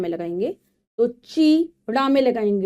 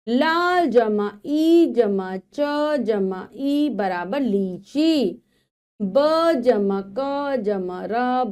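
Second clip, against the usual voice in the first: slow, careful sounding out; teacher reads जमा ई जमा slowly, each sound apart; slow phonics sums; slower than usual. Shown as a demonstration. Teacher shows children how letters add up, slowly. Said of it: "पढ़ते हैं".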